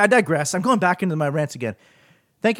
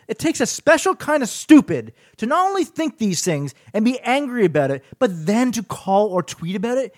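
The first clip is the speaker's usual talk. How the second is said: higher-pitched, exasperated rant about stupid callers; angry rant; 7 semitones higher than usual. Real, not acted. Host ranting at Amber Alert complainers, real exasperated anger. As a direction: vent the frustration, pitch climbing high. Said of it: "So yes, I am very angry about that".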